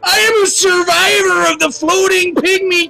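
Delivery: high, loud survivor proclamation; dramatic shout. Acted. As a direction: yell it like a war veteran.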